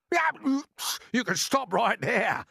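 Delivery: high, loud, slow stop; sharp protest. A narrator's reading. Earthworm stopping James, sharply. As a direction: cut him off hard. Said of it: "the earthworm said sharply".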